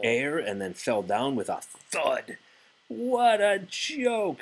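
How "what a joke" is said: high, slow, sneering robin voice; mocking robins. Robins jeering at Toad's kite, high mocking voice. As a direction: jeer down at Toad from the bush. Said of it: "said the robins".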